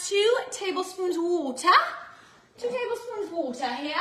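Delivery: playful British "water" with dropped r; lightly hammed British accent; mock British accent. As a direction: slip into a put-on British accent. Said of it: "why we went British".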